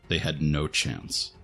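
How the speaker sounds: ordinary delivery, nothing marked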